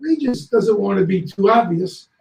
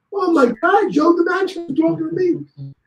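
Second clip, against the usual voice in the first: higher, awestruck re-living of the moment; amazed; 11 semitones higher than usual. Real, not acted. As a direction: let the thrill break through. Awe at meeting father's hero.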